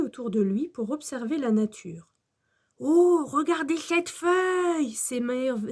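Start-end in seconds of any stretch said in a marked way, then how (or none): 2.8-4.9 slow, high, wondering exclamation; Max émerveillé. A narrator's reading. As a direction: marvel at it like a child. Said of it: "s'émerveille Max". (émerveillement lent et aigu)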